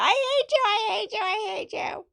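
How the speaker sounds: high, shrieking imitation of a furious teenager; teen tantrum. Teen daughter screaming at her dad, high-pitched imitated shout. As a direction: mock-scream it like a wronged sixteen-year-old. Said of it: "And I said".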